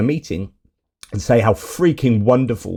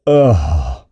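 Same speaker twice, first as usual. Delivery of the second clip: low, falling groan of disgust; disgusted ugh; 6 semitones lower than usual. Real, not acted. Commentator introducing the envoy's clip, disgusted groan.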